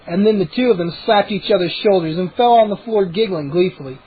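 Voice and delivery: ordinary delivery, nothing marked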